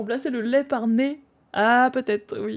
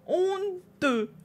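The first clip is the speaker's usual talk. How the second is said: much higher, theatrical mock shame; hammed-up outrage; 10 semitones higher than usual. Streamer hamming up shame at the viewer who stole the answer. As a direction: overplay the scandalised gasp. Repeats scolding, more hammed.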